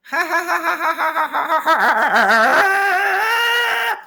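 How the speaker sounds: loud burst of real laughter; cracking himself up. Real, not acted. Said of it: "cracked myself up".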